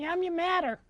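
meowing cat-puppet voice; pussycat puppet. Acted. Cat calling a friend to come, meowing cat voice. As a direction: talk as a little cat, meows between the words.

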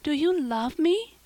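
high, amazed, hopeful question; earnest girlish astonishment; cried in wonder. A narrator's reading. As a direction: ask it in breathless hope, pitched well up. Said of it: "cried Anne, clasping her hands".

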